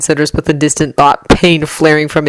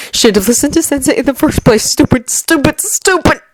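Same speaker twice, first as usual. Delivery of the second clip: high, climbing panic; frantic inner voice building higher; frantic self-blame; 8 semitones higher than usual. A narrator's reading. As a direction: berate yourself, pitch rising on each repeat. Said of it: "he frantically thinks".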